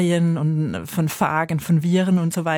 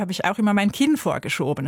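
ordinary delivery

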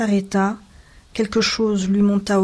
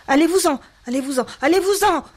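high, quick, repeated outburst; pained cry; 8 semitones higher, faster than usual. A narrator's reading. Wife orders husband out, pained cry. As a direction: drive him out with a cry torn from you. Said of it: "cria-t-elle sans le regarder, comme si ce cri lui était arraché".

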